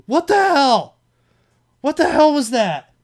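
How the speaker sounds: ordinary talk, nothing marked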